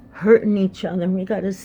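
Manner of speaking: usual speaking voice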